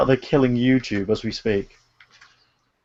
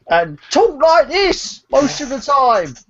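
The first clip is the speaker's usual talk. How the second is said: slow, high comic voice; put-on high voice; 11 semitones higher, much slower than usual. Friends mocking English accents, high comic voice. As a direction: pitch it way up and stretch it.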